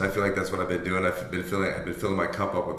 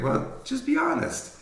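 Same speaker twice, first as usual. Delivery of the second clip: a high, slow imitation of his wife; wife's voice; slower than usual. Imitating his wife as she tells him to just be honest. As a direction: mimic his wife gently, up high and drawn out.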